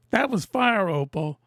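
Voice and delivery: ordinary talk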